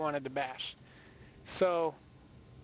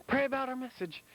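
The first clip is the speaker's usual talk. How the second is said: mock yell, pitched much higher but no louder; high, held-back imitation of yelling; retold yell; 8 semitones higher than usual. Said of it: "And I yelled it really loud".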